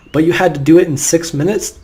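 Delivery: ordinary talk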